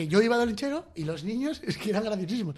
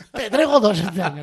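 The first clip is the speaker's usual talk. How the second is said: laughing; 2 semitones higher, 4 dB louder than usual. Retold or imitated.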